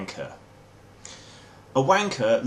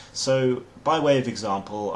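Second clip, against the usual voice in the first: usual speaking voice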